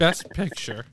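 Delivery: announcement with a cracking voice. Real, not acted. Announcer introduces the big last award, voice cracking. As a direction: let the voice break like in puberty. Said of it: "Why is your voice cracking?".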